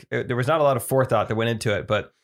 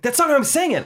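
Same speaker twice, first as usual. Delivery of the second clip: indignant; 4 dB louder than usual. Retold or imitated. Upset friend insists, retold.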